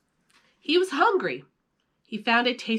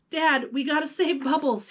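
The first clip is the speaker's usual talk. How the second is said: cried out; 7 semitones higher than usual. Acted. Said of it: "he cried".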